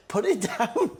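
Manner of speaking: high, laughing plea; laughing mum. Retold or imitated. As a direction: giggle through the words. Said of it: "Mum would be like".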